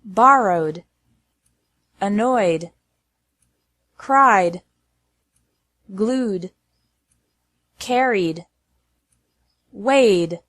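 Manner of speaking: "Borrowed, annoyed, cried, glued, carried, weighed" each ending on a voiced d. Shown as a demonstration. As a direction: glide into a d, no extra syllable. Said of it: "pronounced like D".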